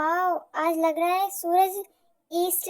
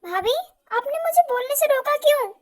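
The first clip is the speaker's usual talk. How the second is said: high, louder indignant protest; upset protest; 9 semitones higher, 3 dB louder than usual. Acted. Sister protesting to her sister-in-law, high and indignant. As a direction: demand why she was silenced.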